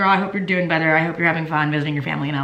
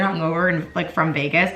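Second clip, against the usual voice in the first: ordinary talk, nothing marked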